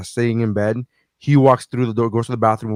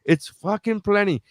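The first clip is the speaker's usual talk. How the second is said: indignant; 9 semitones higher than usual. Retold or imitated. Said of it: "And I'm like".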